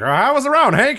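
put-on high, bright old-time announcer. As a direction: play the chirpy TV host. Announcer voiced in retold interview.

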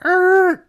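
loud, high screech noise; imitated screeching halt. Husband halting wife's invitation, loud imitated screech. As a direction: screech like brakes slamming on.

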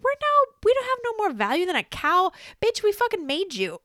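outraged at the AI's insult; indignant outrage. Real, not acted. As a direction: protest it, offended.

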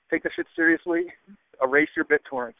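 ordinary talk, nothing marked

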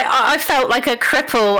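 ordinary talk, nothing marked

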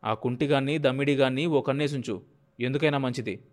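ordinary delivery

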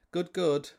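high, breezy "Good, good"; mock customer reply. Customer answers their own question, in a high breezy mock voice. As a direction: toss it off without listening. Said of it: "and they'd say".

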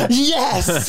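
high, loud fan scream; mock-hysterical cheer; freaking out. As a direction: shriek it like a starstruck fan. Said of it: "and I freak out".

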